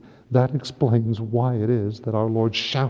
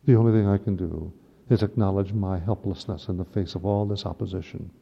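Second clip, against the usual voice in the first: ordinary talk, nothing marked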